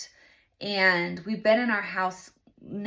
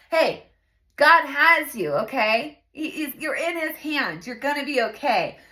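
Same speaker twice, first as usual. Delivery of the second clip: higher, emphatic pep talk to oneself; firm self-reassurance; 5 semitones higher, 5 dB louder than usual. Retold or imitated. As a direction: insist on the comfort, starting loud and easing off. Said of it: "I wanted to tell myself".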